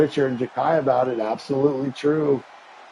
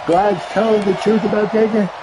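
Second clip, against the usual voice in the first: excited shout; 7 semitones higher, 5 dB louder than usual. Real, not acted. Reading chat comment excitedly.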